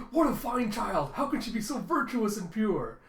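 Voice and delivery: high, loud, overwhelmed admiration; mock awestruck outburst; freaking out. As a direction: gasp it out, hammed-up. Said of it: "Yuri just freaking out".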